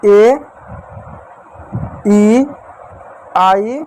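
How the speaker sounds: each vowel called out on its own, with a pause after it; drawn-out vowel names. Shown as a demonstration. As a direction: spell out each vowel slowly for the class. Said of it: "कौन कौन सी?".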